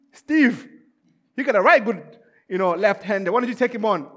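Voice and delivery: acted recruiting shout, easing off; calling out. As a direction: hail someone across the ranks, then coax. Officer calling on a soldier.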